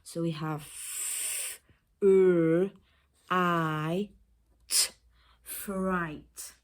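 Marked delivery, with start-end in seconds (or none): 0.6-4.9 f, r, I, t as separate drawn-out phonemes; sounding out. Shown as a demonstration. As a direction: sound out "f, r, I, t", pausing after each. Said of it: "we have two adjacent consonants".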